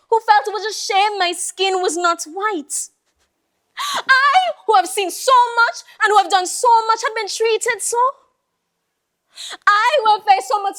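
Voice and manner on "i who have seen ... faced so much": high-pitched, wounded indignation; indignant. Acted. Old Mary protests her shameful treatment, indignant. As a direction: start high on a drawn-up "I", then rush on indignantly.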